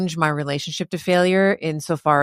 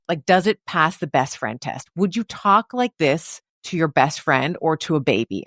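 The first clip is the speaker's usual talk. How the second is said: usual speaking voice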